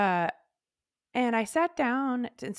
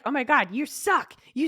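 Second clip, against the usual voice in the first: mock self-berating; 4 semitones higher, 6 dB louder than usual. Acts out cruel mind during panic.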